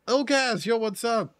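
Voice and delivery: high, upbeat shout-out to a chatter; excited greeting. Real, not acted. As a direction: perk up to greet a friend who just arrived. Greeting a viewer in chat.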